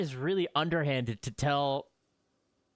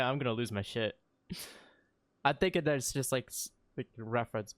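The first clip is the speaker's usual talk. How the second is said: ordinary talk, nothing marked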